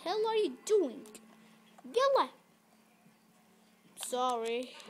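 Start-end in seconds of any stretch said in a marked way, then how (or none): 0.0-2.3 high, loud exasperated yell; yelling at horse. Real, not acted. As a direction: snap at the stubborn horse.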